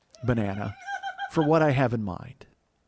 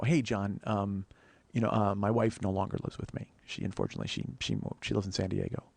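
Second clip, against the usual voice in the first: low, soft, hesitant admission; reluctant confession; 5 semitones lower, 6 dB quieter than usual. Retold or imitated. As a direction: break awkward news gently, nearly losing your voice. Retells breaking awkward news to acquaintance.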